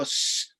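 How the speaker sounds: S as a long unvoiced hiss; teacher modeling the S sound; s sound demo. Shown as a demonstration. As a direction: hiss the S without voice. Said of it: "and then I'll go".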